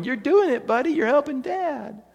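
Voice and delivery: put-on high, cheery praise for a little boy; encouraging dad voice. Dad praising his small son mowing the lawn, high and cheery. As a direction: cheer him on like a proud dad.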